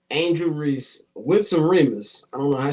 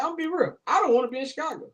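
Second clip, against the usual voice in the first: lightly voiced high quote; a high put-on quoting voice; high mock voice; 11 semitones higher, much faster than usual. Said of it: "I was like".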